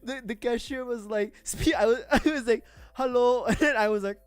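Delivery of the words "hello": put-on German-accented hello. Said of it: "the way I would say hello".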